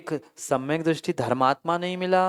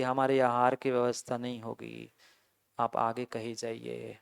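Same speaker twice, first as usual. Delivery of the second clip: mildly imitated plea, lower and softer; folded-hands polite refusal; 5 semitones lower, 8 dB quieter than usual. Town community turning away the visiting monks, retold as a soft folded-hands plea. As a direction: softly act out an apologetic refusal. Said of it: "हाथ जोड़ के कह रही".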